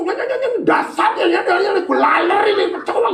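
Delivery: high, rattling mock chatter of a loudmouth; squeaky mock blabber; mocking a blunt talker. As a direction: parody someone who says anything. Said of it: "Kan ada orang nyablak".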